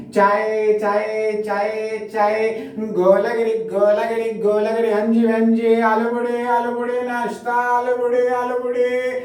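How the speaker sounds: high, monotone chanted hawking; platform vendor's cry. Acted. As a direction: hawk snacks in a repeating high chant. Train vendors calling their wares. (फेरीवाले की ऊँची, लयबद्ध हाँक)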